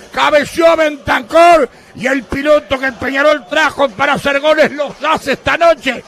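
screaming goal call, high and loud; breakneck goal commentary. Real, not acted. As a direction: roar the equaliser over the radio.

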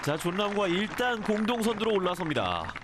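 rising excited live call; race caller. Real, not acted. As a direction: call the putt as it drops. Live call of eagle putt dropping.

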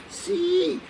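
very high, drawn-out squeak; thin yes. Retold or imitated. Doctor demonstrating his embarrassed answer, thin high voice. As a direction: let the answer come out tiny and high. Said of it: "el sí me salió un poco fino".

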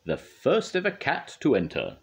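high, measured show announcer; announcing. A narrator's reading. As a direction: present the contestants to the audience. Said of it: "Missus Percival announced".

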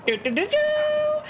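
high sung horn toot; horn fanfare. Retold or imitated. Mimicking the finish line horn as the snail wins. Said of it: "you hear, uh, a horn".

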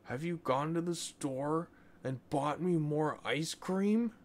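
put-on high childish demand rising at the end; boy pestering his mother, high and pleading. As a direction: push the question like a spoiled kid. Boy demanding ice cream.

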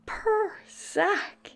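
plain reading voice

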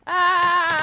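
high, loud yelp; startled scream. Real, not acted. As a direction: yelp at the sudden alert. Startled by sound alert.

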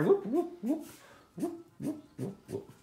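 high, playful whoop whoop as each hair is drawn; whooping sound effects. Shown as a demonstration. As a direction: swoop the voice up on every whoop. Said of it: "you have to make the sound effects".

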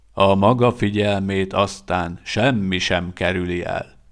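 plain reading voice

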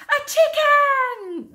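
very high, slow, loud cry; amazed shout. A narrator's reading. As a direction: shout it drawn out, like a whole crowd. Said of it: "they all shouted".

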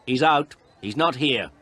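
high, loud brush-off; curt dismissal. Acted. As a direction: send the caller away impatiently. Woman turns the caller away.

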